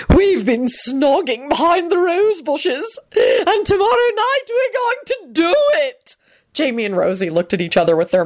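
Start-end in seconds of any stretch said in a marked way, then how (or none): 0.1-6.1 high, slow, scandalous relish; wicked gloating. A narrator's reading. Lady flaunting her affair to Richard, slow and wicked. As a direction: draw each word out high to shock. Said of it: "Lady Fanshawe hissed wickedly".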